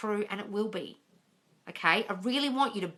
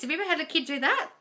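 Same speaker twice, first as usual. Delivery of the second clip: ordinary delivery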